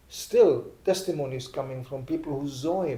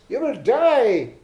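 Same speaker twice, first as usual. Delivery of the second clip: grave warning; 8 semitones higher, 6 dB louder, much slower than usual. Retold or imitated. Woman warns old swami.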